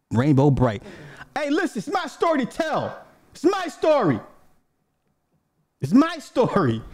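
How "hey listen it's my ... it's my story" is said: high, defensive insisting; playful protest. Real, not acted. Defending his story to his wife, high and playful. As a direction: pitch up to fend off the wife.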